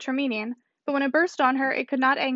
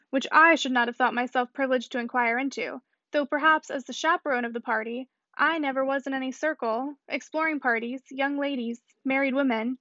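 plain reading voice

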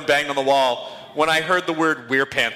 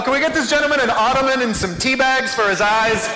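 higher, hammed-up fawning over the guest; mock pampering host; 4 semitones higher than usual. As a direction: play the gushing servant, lightly laughing. Mock pampering an audience member.

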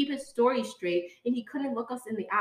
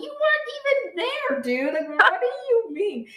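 indignant disbelief; 11 semitones higher, 4 dB louder than usual. Retold or imitated. Confronting the wingman, indignant.

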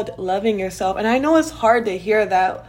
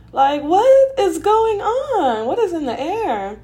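exasperated disbelief; 9 semitones higher, 4 dB louder, slower than usual. Real, not acted. Venting disbelief at her losses.

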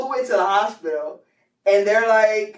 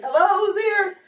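high-pitched retold call into the house; 12 semitones higher than usual. Mom coming home to find who's there, calling out.

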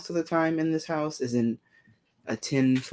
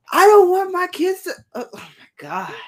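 sudden high, loud mock protest; mock outraged parent; 13 semitones higher, 7 dB louder than usual. Imitating a parent objecting about middle school books, mock outrage. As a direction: burst out like a scandalized parent, hammed up.

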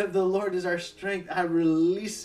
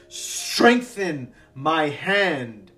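loud, slow plea; 9 dB louder, much slower than usual. Acted. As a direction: cry out slowly to God. Nehemiah's cry to God.

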